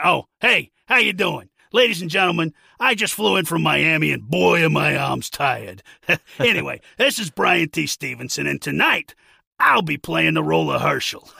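loud cheesy stand-up comic voice; old borscht belt comic. Retold or imitated. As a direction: sell it like a hack comedian on stage. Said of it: "a cheesy, you know, dad joke comedian".

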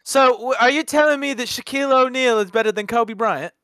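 incredulous mock British accent. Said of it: "talk in a British accent".

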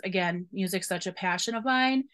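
ordinary delivery

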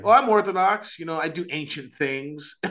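high, smug show-off voice; mocking poseur. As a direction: parody a pretentious convert.

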